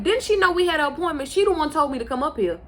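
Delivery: incredulous, wanting answers; exasperated demand. Retold or imitated. Waiting on the doctor, exasperated and loud. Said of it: "And I'm like".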